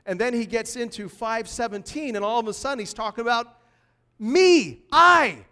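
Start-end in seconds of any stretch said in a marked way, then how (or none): 4.2-5.5 "me" and "I" shouted high and loud; preacher hammering Jesus' first person to his church, loud and high; emphatic me, I. Shown as a demonstration.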